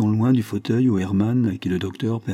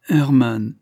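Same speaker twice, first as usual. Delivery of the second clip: the name louder and higher, falling; insistent appeal; 4 semitones higher, 6 dB louder than usual. A narrator's reading. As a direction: call his name as a heartfelt reproach. Pleads, repeating his name.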